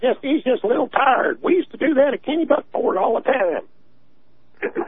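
high-pitched put-on good-old-boy excuse; mocking imitation. Caller imitates the leader shrugging off the boy's ordeal, mocking. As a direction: pitch it well up, imitating someone brushing it off.

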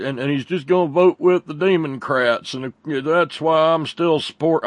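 lightly voiced mock of the congressman, drawn out; mocking Mo Brooks. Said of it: "answer was".

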